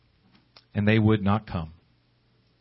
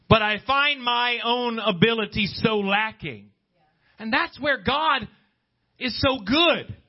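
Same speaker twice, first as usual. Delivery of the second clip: preaching; 10 semitones higher, slower than usual. Acted. Preaching at full force.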